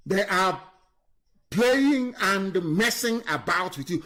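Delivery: slow, spell out playing and messing; deliberate, weighty slowdown; slow emphatic repeat. Real, not acted. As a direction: drive each word home slowly.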